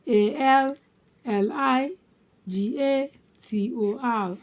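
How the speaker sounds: letters in groups with pauses between; spelled by syllables. Shown as a demonstration. Teacher spelling alligator for learners, syllable by syllable. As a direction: spell it out, lifted chunks settling at the end. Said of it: "Alligator divided into four syllables".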